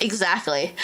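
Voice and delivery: ordinary delivery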